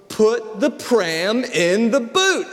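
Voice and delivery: spelled out slowly for a clueless visitor; slow and deliberate, word by word; the host repeating herself slowly. Retold or imitated. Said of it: "and she goes".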